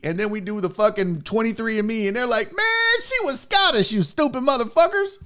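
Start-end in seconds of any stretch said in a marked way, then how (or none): 2.5-5.2 high-pitched mocking rebuke; scornful verdict. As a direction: deliver a scornful, high-voiced put-down. Mocking imagined DNA test verdict.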